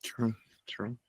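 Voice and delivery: breathless whispered agreement. Real, not acted.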